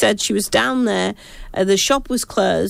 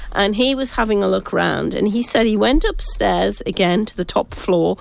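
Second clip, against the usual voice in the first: ordinary talk, nothing marked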